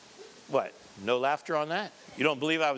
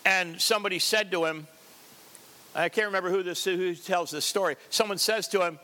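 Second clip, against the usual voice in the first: usual speaking voice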